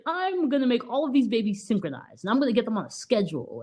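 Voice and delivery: a hint of a drill-sergeant nanny; mock super nanny. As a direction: play the nanny taking charge. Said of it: "super nanny about it".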